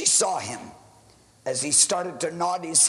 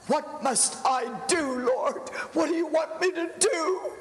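raised, crying out to God in anguish; a desperate pastor begging the Lord at night; pleading prayer; 7 semitones higher than usual. Acted. Pastor alone in his church, pleading prayer to God.

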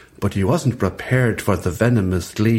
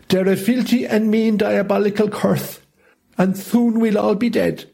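raised, higher dinosaur voice full of outrage; indignant dinosaurs; 10 semitones higher than usual. A narrator's reading. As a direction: cry out that they'll all be dead. Dinosaurs speak.

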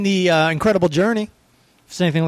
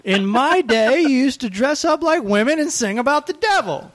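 put-on higher, laughing grumpy old man rant; Bill Burr as an old man; 8 semitones higher than usual. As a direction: rant like a cranky old comic. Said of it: "That's a Bill Burr. That's him being an old man".

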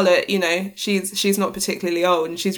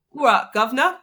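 hammed-up British accent, rising; playful fake British accent; mock British accent; 5 semitones higher than usual. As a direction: play a silly British accent. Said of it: "super posh British accent".

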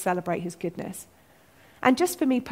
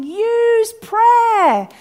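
high, loud, long-drawn shout; God as Father shouting; 13 semitones higher, 8 dB louder than usual. Retold or imitated. Father calling to his kids facing trouble, shouting. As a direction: holler it urgently to a struggling child. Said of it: "God as Father shouting".

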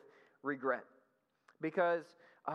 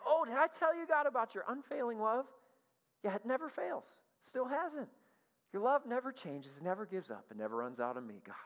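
high, gushing praise to flatter God; butter God up, sweet and eager; mock fawning flattery of God; 7 semitones higher than usual. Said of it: "suck up to you".

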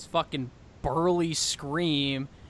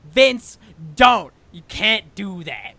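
pleading shout; 5 semitones higher, 10 dB louder, much slower than usual. Retold or imitated. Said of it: "she's like".

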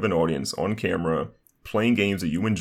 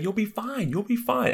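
high-pitched reassurance; slower than usual. Retold or imitated. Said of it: "I'm like".

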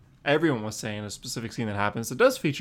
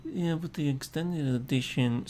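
inner J D voice; 2 semitones higher, 4 dB quieter than usual. Pretend. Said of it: "my inner J D is coming out".

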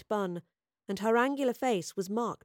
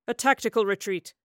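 louder, mildly defensive protest; 5 dB louder than usual. A narrator's reading. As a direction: protest as an officer defending himself. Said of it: "Quintus protested".

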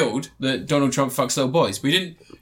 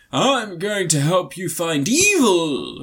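slow solemn promise; much slower than usual. Retold or imitated. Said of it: "Russell Crowe's like".

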